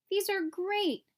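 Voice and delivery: high, bright delighted cry; light up with joy; a turtle thrilled with his friends' cards; delighted exclaim. A narrator's reading. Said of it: "exclaimed Franklin".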